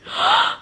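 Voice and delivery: high, sharp gasp; shocked gasp. Real, not acted. Reader near the book's end, high shocked gasp. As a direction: suck in air in shock. Shocked by what she reads.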